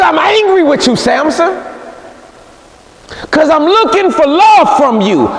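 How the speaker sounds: a wounded lover accusing him; Delilah's complaint. Acted. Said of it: "She said".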